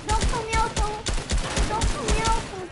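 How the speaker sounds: high, loud desperate yell; pleading shout. Real, not acted. Gamer shouting at the enemy not to kill Meowscles, pleading yell. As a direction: yell it out to save your teammate. Begging opponent to spare Meowscles.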